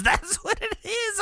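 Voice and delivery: high and giggling; laughing. Real, not acted. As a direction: laugh through the repeat. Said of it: "but that's what it is".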